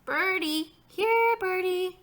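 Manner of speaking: put-on high little-kid voice; lightly hollered small-child call; toddler calling. A narrator's reading. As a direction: call to a bird, drawn-out and high. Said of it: "he called".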